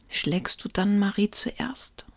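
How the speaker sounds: slow, timid near-whispered question; trembling voice. A narrator's reading. Frightened Lukas bargains with Ruprecht, trembling voice. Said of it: "mit zitternder Stimme".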